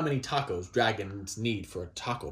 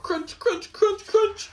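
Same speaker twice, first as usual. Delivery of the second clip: higher, rising crunching sounds; playful crunch sound effect; 6 dB louder, slower than usual. Pretend. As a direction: chomp it out like munching dragons.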